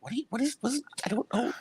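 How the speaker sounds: mock bafflement. Imitating himself lost in Joey's argument, high baffled stammer. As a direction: squeak out helpless confusion, quietly. Acts out own bafflement at Joey.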